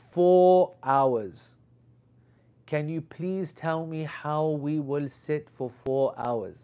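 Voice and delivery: mimicking her shock, drawn out slowly; loud, stretched "four hours", then slow, stunned plea; shocked disbelief. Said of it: "And her shock and fear".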